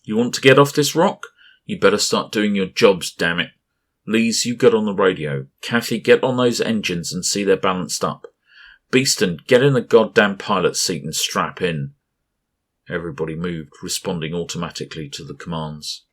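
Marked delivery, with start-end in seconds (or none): 0.0-11.9 loud commanding shout at the crew; barked orders. A narrator's reading. Captain shouting orders at his crew, loud and commanding. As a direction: bark orders like a captain in crisis.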